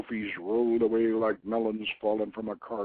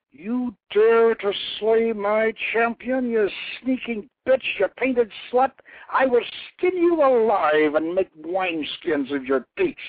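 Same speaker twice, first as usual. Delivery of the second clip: furious goddess; 11 semitones higher, 4 dB louder than usual. A narrator's reading. War goddess Anath rages at her champion's killer. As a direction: spit each insult like a curse.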